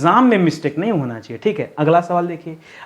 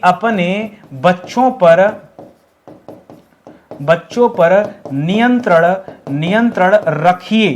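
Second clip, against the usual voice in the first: slow, careful reading of the correction; slow dictation; slower than usual. Shown as a demonstration. Teacher slowly giving students the right phrase. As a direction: draw out each word for students. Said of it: "क्या होना चाहिए".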